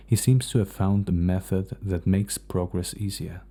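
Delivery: ordinary delivery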